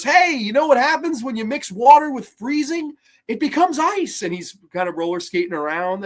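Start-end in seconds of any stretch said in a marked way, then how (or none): 0.0-4.2 high, hammed-up wisecrack; goofy higher smart-aleck voice; mock Val Kilmer quote. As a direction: play the smug genius showing off. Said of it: "And he goes".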